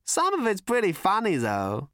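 high, amused character voice; giggly Prack. A narrator's reading. Prack recalls the funny bits to his visitors, giggly. Manic witness amused by own memory.

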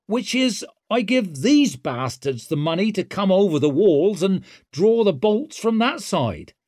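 high, cocky and loud; swaggering rogue taunting; brash young woman. A narrator's reading. Ricker voice.

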